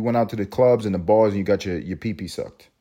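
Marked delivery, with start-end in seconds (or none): none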